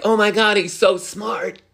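high-pitched gushing fan impression; imitated starry-eyed swooning; mock admiration. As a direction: gush it like a smitten fan, drawn out. Imitating admiring fans of bad characters.

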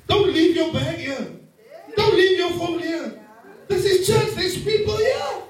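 mock warning to wife. Pastor mimicking his warning to his wife, rising to a shout.

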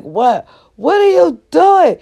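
high loud shout back. Retold or imitated. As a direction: yell up at mom. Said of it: "I'm like".

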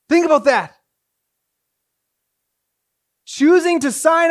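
loud, high-pitched sermon delivery; impassioned preaching. Acted. Preacher urging the congregation on adoption, loud and impassioned. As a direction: preach it out with full force.

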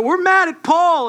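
hammed-up indignant reply; mock mad yelling. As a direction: shout back like an angry mob member. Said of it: "so mad and yelling".

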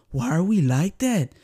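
ordinary delivery

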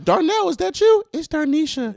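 very high put-on voice; mock comic dialogue. Friend acting out Darnell becoming Darnisha, in a mock high voice.

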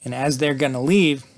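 ordinary delivery

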